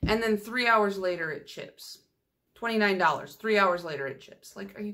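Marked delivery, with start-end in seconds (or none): 1.3-2.0 dropped to a hushed, breathy deadpan. Real, not acted. Telling viewers the pricey polish chipped, hushed deadpan. Punchline after hyped purchase. 4.1-4.5 dropped to a hushed, breathy deadpan. Real, not acted. Telling viewers the pricey polish chipped, hushed deadpan. Repeated punchline.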